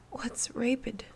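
high, unvoiced little girl whisper; child whisper. A narrator's reading. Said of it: "Anna whispered".

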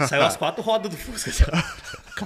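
words broken by laughter; laughing through the story. Real, not acted. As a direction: tell it while cracking up. Laughing while telling crash.

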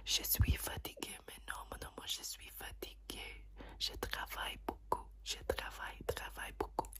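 soft, unvoiced French whisper. Shown as a demonstration. Doctor testing patient's hearing, whispered French. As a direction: whisper French close to the ear. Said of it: "whisper some languages in your ear".

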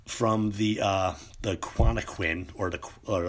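ordinary talk, nothing marked

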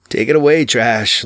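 ordinary delivery

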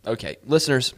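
ordinary delivery

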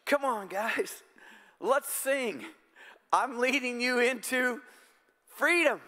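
high, half-sung worship-leader call; playful high call to sing along; God as worship leader. Pretend. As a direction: lift it up like leading the congregation in song. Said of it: "God leads the worship team".

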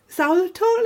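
usual speaking voice

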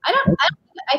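ordinary delivery

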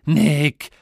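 loud angry shout of the name. Acted. Father shouting son's name, loud. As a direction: call him out sharply. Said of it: "hat Papa gerufen".

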